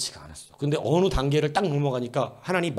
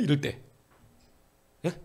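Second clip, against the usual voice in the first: ordinary delivery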